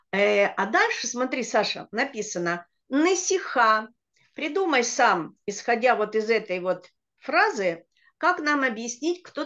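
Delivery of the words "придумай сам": ordinary talk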